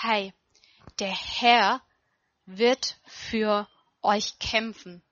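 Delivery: slow, urgent insistence on every word. Retold or imitated. Moses urging the trapped people, slow and insistent. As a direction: press it home slowly, leaning on Herr and wird. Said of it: "sagt dem Volk nochmal so eindringlich".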